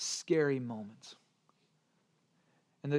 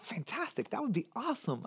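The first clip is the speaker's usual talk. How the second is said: higher-pitched, upbeat agreement; delighted yes, pitched up; retold enthusiasm; 7 semitones higher, 4 dB quieter than usual. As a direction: gush lightly, like an eager dad. Father's retold enthusiastic reply.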